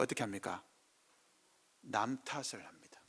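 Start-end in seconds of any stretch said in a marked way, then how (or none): 1.8-3.0 whisper. Real, not acted.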